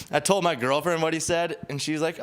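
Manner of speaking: normal talking voice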